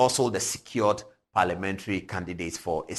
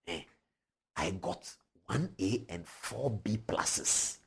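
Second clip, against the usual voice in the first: senior lecturing fresher; 8 dB quieter, slower than usual. Retold or imitated.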